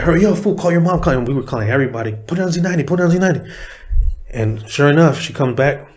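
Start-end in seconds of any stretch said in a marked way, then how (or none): 0.0-1.2 high-pitched excited urging; acting out an overjoyed buddy; giddy excitement. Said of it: "all happy and shit, almost crying". 2.3-3.4 high, excited repeated urging; retold giddy calling round; giddy excitement. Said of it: "all happy and shit, almost crying".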